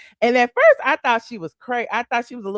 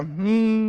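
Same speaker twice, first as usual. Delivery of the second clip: soft closed-mouth hum, the engine revved; quiet car hum. Shown as a demonstration. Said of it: "You crank it up, it go".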